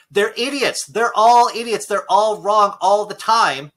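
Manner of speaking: loud, high, slow furious rant; angry rant. Real, not acted. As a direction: hammer each word at anti-vaxxers. Ranting about anti-vaxxers.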